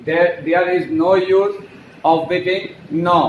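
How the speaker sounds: very slow, word-by-word English; slow teacher model sentence; slow dictation. Shown as a demonstration. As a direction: dictate it slowly to the class. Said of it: "तो ऐसे sentence को हम there से इस्तेमाल करते हैं".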